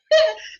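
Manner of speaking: short high squeal of laughter; a quick helpless laugh. Real, not acted. Preacher still laughing at her cousin's answer, high squeal.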